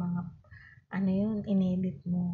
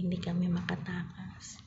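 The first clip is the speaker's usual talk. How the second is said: ordinary talk, nothing marked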